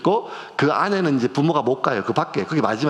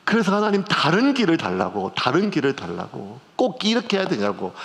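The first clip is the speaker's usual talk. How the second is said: usual speaking voice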